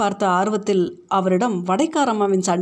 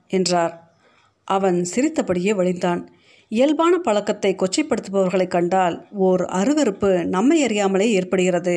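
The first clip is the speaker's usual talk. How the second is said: ordinary delivery, nothing marked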